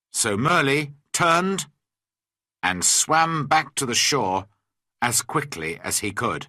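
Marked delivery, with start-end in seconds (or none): none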